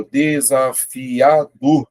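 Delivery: "desafiador" very slow, one syllable at a time. Shown as a demonstration. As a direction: stretch "desafiador" out as de-sa-fi-a-dor. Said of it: "a separação silábica".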